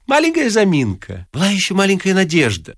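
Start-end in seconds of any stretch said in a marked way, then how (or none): 0.1-1.3 high, sweet excuse; smooth it over with a smile; honeyed stalling; charming reassurance. A narrator's reading. Ostap charms the clerk.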